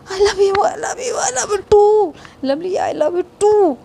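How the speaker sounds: high, slow, crying-happy shout; overjoyed declaration, half sobbing; joyful cry. Acted. Shouting love reply in joy.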